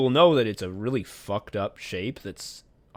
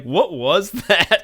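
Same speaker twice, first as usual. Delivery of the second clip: louder, higher, drawn-out question; mock bewilderment; 7 semitones higher, 7 dB louder, slower than usual. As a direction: imitate a baffled onlooker, stretching it out. Acting out another character's baffled reaction.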